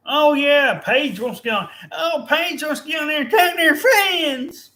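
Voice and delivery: high, loud mock-excited voice; mocking wrestlers. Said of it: "These wrestlers".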